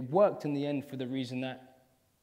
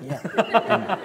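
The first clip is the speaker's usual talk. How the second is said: high, loud drawn-out cool yeah; cool teacher yeah; 9 semitones higher, 10 dB louder than usual. Retold or imitated. Student recalling the cool teacher, high hammed-up yeah. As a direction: ham up the laid-back teacher's approval. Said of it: "He's like".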